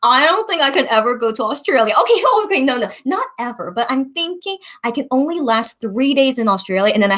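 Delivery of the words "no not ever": ordinary talk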